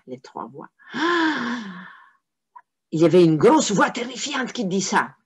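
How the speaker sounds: plain reading voice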